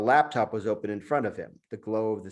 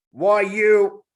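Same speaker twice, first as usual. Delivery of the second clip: high, loud outraged monkey yell; enraged monkey; 10 semitones higher, 10 dB louder than usual. Acted. The monkey yells at his master before attacking. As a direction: lunge with the words.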